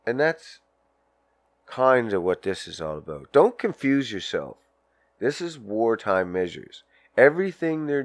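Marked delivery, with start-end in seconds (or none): none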